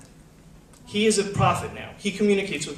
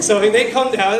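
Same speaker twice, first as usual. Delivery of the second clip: ordinary delivery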